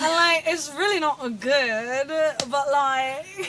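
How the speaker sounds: slow, exaggerated Australian that slips into British; attempted Australian accent. Shown as a demonstration. Co-host showing off a failed Australian try, shaky British-Australian accent. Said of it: "comes out a little British".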